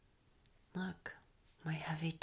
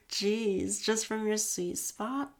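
louder, higher teasing disbelief; amazed; 4 semitones higher, 7 dB louder, slower than usual. Acted. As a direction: marvel at him, laughing it out. Amazed reaction to his climax.

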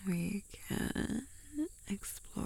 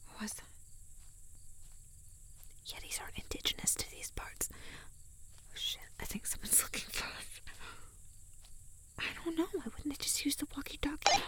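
whispering; 8 semitones higher, 5 dB quieter than usual. Acted. Hiding, someone looking for them.

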